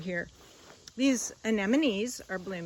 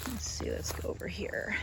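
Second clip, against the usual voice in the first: whispering; 4 dB quieter than usual. Real, not acted. Quiet aside while walking garden.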